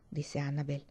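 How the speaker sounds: ordinary delivery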